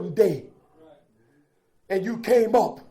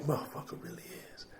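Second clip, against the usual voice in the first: whisper; 6 semitones lower, 18 dB quieter, faster than usual. Real, not acted. Whispered point.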